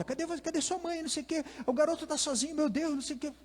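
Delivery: very high, small piping voices; fussing adults. Retold or imitated. Adults crowd a lost child, in high mocking voices. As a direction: mimic a crowd of clucking grown-ups.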